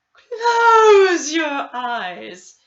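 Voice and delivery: loud, slow, drawn-out therapist voice; hammed-up new-age therapist, loud and slow. A narrator's reading. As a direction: boom it out like a mystic healer.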